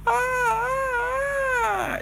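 long high-pitched scream; sliding scream. Acted. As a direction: scream all the way down the slide. Kena grita al deslizarse. (grito agudo y largo de bajada)

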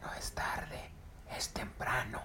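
hushed whispered correction. Acted. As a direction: whisper it, keep it secret. Monkey whispers reply.

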